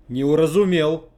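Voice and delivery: loud, ringing outburst. Acted. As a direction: burst out in a ringing voice. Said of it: "признался звенящим голосом".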